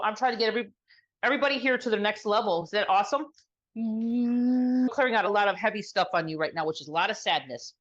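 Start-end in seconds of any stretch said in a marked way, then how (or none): 3.8-4.9 steady, drawn-out humming tone; healing hum. Real, not acted. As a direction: hum one long note to clear energy. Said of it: "with some sound".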